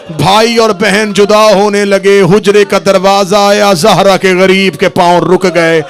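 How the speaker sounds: loud, high grieving recitation; full-force majlis lament. Acted. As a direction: preach the farewell at full force, wailing. (पूरे ज़ोर से रोता हुआ मजलिसी बयान)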